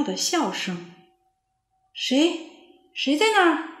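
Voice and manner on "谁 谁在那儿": frightened, calling higher into the trees; scared question. A narrator's reading. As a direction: ask who's there, afraid. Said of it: "聪聪害怕地问". (害怕地问)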